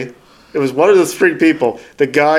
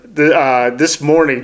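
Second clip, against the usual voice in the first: ordinary delivery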